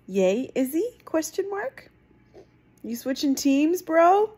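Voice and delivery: mock-accuse the character; surprised teasing. Real, not acted.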